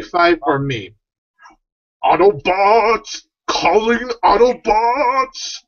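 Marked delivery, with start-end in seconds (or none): 2.0-5.6 slow, higher drawn-out robot leader call; Optimus Prime impression. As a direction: call the Autobots slowly like a movie hero. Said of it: "judge who does a better Optimus Prime impression".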